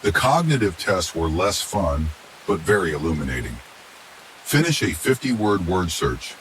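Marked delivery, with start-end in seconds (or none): none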